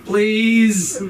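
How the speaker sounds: high, loud whimpering "Mom, please"; mock-sobbing kid calling for mom; whiny sobbing plea. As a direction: beg like a crying teenager. Sobbing kid begging mom on mountain.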